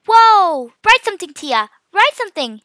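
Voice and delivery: high, loud urgent cry; excited exclamation. A narrator's reading. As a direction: burst out, urging your sister on. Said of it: "she exclaimed".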